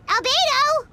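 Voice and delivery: surprised call. Acted. Paimon suddenly noticing Albedo nearby, surprised call. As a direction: call out the moment you spot him.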